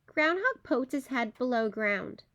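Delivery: ordinary delivery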